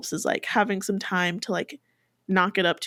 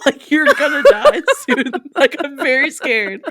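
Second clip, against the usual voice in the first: mock scared, laughing, a touch louder; laughing mock fear; 5 semitones higher, 5 dB louder than usual. Teasing her sleepy friend about dying, laughing mock fear. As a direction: play at being terrified while cracking up.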